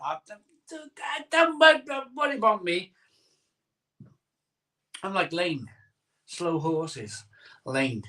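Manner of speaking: usual speaking voice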